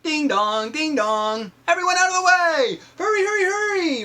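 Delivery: high loud clanging ding dong and shout; high, fast, loud fire truck call; fire engine call. Acted. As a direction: clear the road like a siren. Fire engine bell and firemen.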